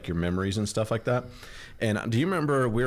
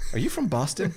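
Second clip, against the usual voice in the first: curious stranger; 8 semitones higher than usual. Retold or imitated. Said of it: "everybody always wants to ask".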